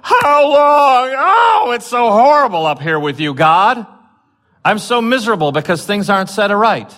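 high, loud, whiny moaning; mock whining complaint. Preacher mocking the wrong reading, a high loud whine. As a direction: ham up a miserable whiner. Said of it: "That doesn't mean that they're going".

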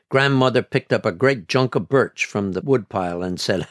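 ordinary delivery

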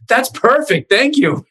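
high, gleeful thanks; mock delighted. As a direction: ham up the delight. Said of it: "And I said".

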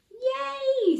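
very high yay; excited cheer. Acted. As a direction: cheer with relief. Rescued characters cheer.

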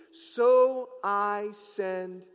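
loud, drawn-out "so I send"; call and response. Shown as a demonstration. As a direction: lead the congregation to say it together, stretching each word. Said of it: "say it with me".

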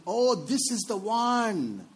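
high, drawn-out gush of an imitated teen; lovestruck teenager. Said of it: "when they say".

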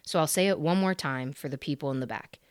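ordinary talk

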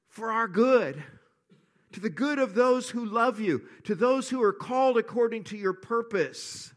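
high, loud, soaring sermon climax; preaching at full force. Acted. Pastor rallies his church in trust, fervent preaching. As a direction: lift the church with fervour.

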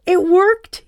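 high, loud excited squeal. Acted. Son squealing in triumph at his mum. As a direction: squeal it out with glee. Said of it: "he squealed".

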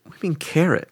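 high, incredulous, a bit hushed; can't believe what she just heard; shocked question. Retold or imitated. Girlfriend baffled by a name she doesn't know, high and incredulous.

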